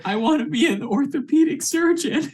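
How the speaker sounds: very high, laughing delivery; joking high voice breaking into laughter; high-pitched character quip. Acted. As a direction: squeak it out through a laugh. Player voices Doctor Janice, laughing.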